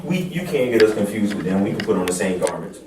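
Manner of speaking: normal talking voice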